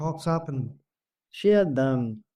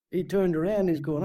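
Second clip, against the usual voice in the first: usual speaking voice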